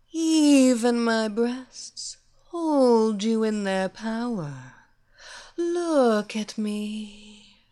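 slow, sultry villainess luring her victim; seductive hypnotist. Acted. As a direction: purr it like a temptress casting a spell.

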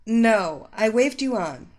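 loud, firm refusal; irritated rebuff. A narrator's reading. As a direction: brush the stranger off. Woman rebuffs the stranger.

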